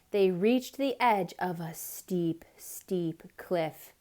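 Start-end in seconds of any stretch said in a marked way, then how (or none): none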